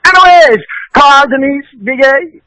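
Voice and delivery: high, projected call-out of names; promo shout-out. Acted. Guest ending the bumper with a shout-out to hosts, high call-out.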